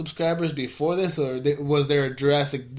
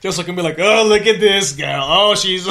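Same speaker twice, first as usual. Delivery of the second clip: gleeful; 4 semitones higher, 6 dB louder than usual. Real, not acted. Gleeful gossiping, loud and laughing.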